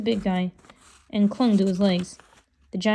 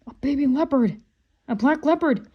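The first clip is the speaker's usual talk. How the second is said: plain reading voice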